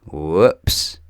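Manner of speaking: ordinary delivery, nothing marked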